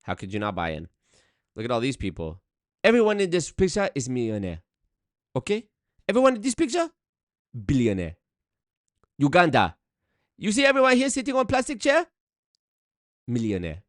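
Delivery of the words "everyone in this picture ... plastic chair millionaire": hammed-up Nigerian hype voice, higher; mock Nigerian accent. Mocking scam brochure photos, Nigerian accent. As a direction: boast about the millionaires in Nigerian accent. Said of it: "in the Nigerian voice the whole time".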